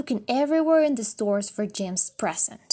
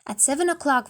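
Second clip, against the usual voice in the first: plain reading voice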